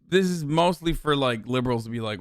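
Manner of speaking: ordinary talk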